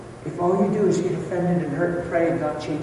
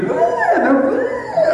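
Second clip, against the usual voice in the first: very high, comic bawling; hammed-up sobbing wail; mock crying wail; 4 dB louder, much slower than usual. Couple crying together in the yard, mock high bawling. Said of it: "I started crying so hard".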